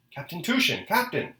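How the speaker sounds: high, urgent call for the captain. A narrator's reading. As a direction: call out to someone, pitch jumping up. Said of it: "unfamiliar voice called above his head".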